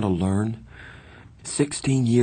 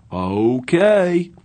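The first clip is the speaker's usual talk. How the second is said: loud, high, drawn-out cheery okay; cheerful; 9 semitones higher, 6 dB louder than usual. A narrator's reading. Two-Bit agreeing to run to the drugstore, cheerful. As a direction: sing it out cheerfully. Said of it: "Two-Bit said cheerfully".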